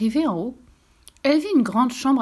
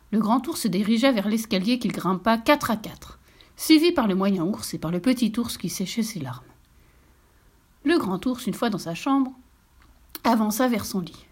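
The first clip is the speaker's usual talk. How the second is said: ordinary delivery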